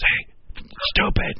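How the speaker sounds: mock prompter's whisper; stage whisper. Host playing a prompter for a forgetful actor, stage whisper. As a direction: hiss it like a prompter in the wings. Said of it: "somebody who whispers from the side".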